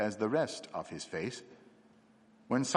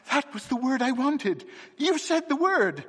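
high, loud eager cry; a sudden eager outburst, pitched high; excited cry; 14 semitones higher, 4 dB louder than usual. Acted. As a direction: cry out the discovery with rising excitement. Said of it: "and he cried".

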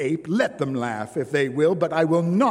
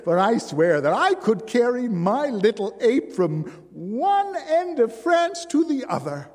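higher, louder triumphant bride; exultant cry; 8 semitones higher, 5 dB louder than usual. A narrator's reading. Bride accepting Simon's wager, triumphant cry. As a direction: shout the bet with glee. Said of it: "the bride cried".

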